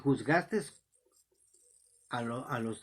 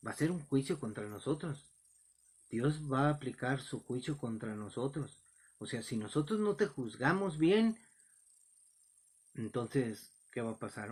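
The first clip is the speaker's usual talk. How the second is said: usual speaking voice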